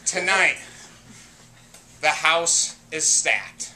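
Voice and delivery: low, measured introduction; dramatic announcer. Acted. Host introducing the challenger team, dramatic announcer.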